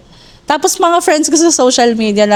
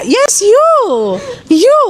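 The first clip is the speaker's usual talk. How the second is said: strict rich lady; 10 semitones higher, much slower than usual. Retold or imitated. Rich old matron in Alabang calling the speaker over, high and imperious. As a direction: point her out like a rich matron summoning someone. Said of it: "Tas sabi niya".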